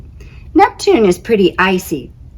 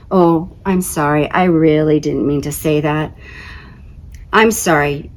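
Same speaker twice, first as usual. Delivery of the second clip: plain reading voice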